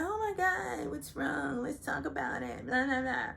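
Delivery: high, pushy mock concern; high nagging imitation of a pushy friend; mocking Kyle. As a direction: badger her in a high mock voice. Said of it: "is badgering her".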